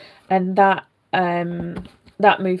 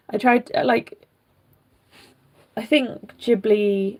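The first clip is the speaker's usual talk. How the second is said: ordinary talk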